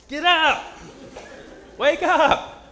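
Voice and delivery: high, loud wake-up yell; urgent waking shout. Acted. Preacher acts disciples waking Jesus in a storm, urgent shout. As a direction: shout to rouse a sleeper in a storm. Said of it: "How would you wake Jesus up if you've got a storm crashing".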